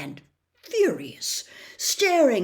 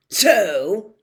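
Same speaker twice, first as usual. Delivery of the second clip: loud snapped word; 3 semitones higher, 10 dB louder than usual. A narrator's reading. Uncle snapping at Harry, loud. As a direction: snap it loud. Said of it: "snapped Uncle Vernon".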